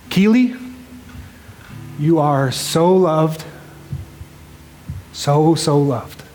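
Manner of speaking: slow, tender words to a daughter; father's slow tearful blessing. Real, not acted. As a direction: hold back tears, speak slow. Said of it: "'cause I'll cry harder".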